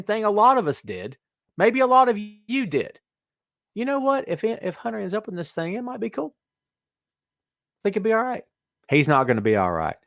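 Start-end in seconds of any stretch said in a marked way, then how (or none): 3.7-8.4 high, soft, tentative hopeful voice; wishful fan. Acted. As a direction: float it up lightly, as naive wishful thinking. Acts out earlier wishful thinking.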